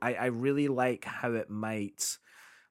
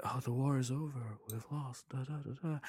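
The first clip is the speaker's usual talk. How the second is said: quiet; 10 dB quieter than usual. Retold or imitated. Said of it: "and he's like".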